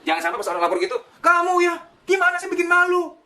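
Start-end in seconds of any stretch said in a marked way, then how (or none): 1.2-3.2 much higher, louder shaming scold; angry parent pointing at the child; shrill scolding. Acted. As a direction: shame the child, voice shooting up. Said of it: "Nunjuk aja gitu".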